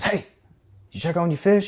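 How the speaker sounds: dad calling. Retold or imitated. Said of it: "he comes in and goes".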